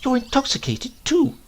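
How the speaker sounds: put-on high indignant old maid; shrill scolding spinster. A narrator's reading. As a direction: look down your nose and scold. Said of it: "she said".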